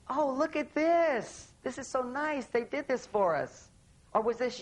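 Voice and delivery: light imitation of a pleased wife; delighted wife. Wife admires anniversary table, lightly voiced delight. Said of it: "She says".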